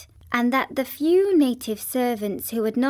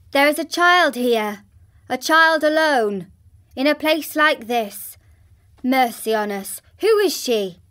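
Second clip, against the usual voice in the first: louder and higher, startled exclamation; shocked officer, raised and urgent; cried out in alarm; 3 semitones higher, 6 dB louder than usual. Acted. Officer discovering the forgotten child, startled loud cry. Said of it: "he cried out".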